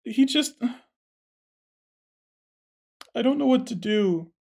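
hammed-up weepy lament; distraught. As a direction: plead helplessly through tears. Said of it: "she says".

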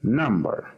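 Number with the stress on "Num"; Number, first syllable stressed and falling. Shown as a demonstration. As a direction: lean on the start of Number. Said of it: "Primera sílaba. Es la sílaba estresada".

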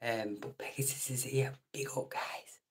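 softly whispered shout-out. Real, not acted. Shouting out a viewer while his wife sleeps, whispered. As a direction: keep the greeting hushed and secret. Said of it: "the missus is in bed".

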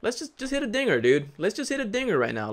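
high, pleading urge to the batter; pleading for a homer. Real, not acted. Gamer begging his batter in a high pleading voice. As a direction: squeak it out, begging for a dinger.

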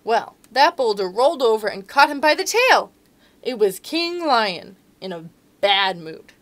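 put-on lively read-aloud to children; storybook narration. A narrator's reading. Reading to children.

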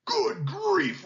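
high, startled lion outburst; roaring lion. A narrator's reading. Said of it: "roared the lion".

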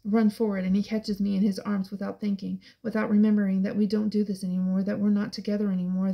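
ordinary delivery, nothing marked

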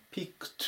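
ピックト ending in a voiceless t; ピックト with -ed as a crisp unvoiced t. Shown as a demonstration. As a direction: say ピックト as "pikt", no d sound. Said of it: "後ろも濁らずに".

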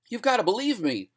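high, urgent plea; desperate scream. A narrator's reading. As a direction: beg frantically to be believed. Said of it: "screamed Crawford".

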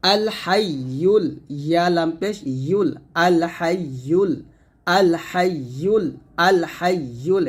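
slow আল হাইয়ুল with a clear ইয়ুল; syllable by syllable হাইয়ুল; careful pronunciation. Shown as a demonstration. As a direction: say হাইয়ুল with a y sound, not a glottal. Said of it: "আল হাইয়ুল বলতে হবে".

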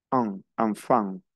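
enfant slow, both an sounds nasal, anfan; nasal vowel. Shown as a demonstration. Teacher slowing down a French nasal: an, enfant. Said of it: "como si me tapara la nariz".

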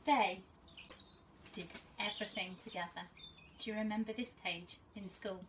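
teacher reading to her class, gentle and expressive; storybook reading. Acted. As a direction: read it like a teacher sharing a picture book with her class. Reads picture book to pupils.

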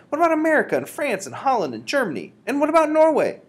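building high, loud alarm; cried out. Acted. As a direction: list the countries rising to a shout. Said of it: "I cried".

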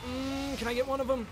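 squeaky eager question; excited high pitch. Real, not acted. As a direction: pitch way up, eager to grab a kill. Eager for a kill.

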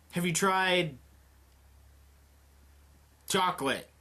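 normal talking voice

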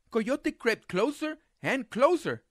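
ordinary delivery, nothing marked

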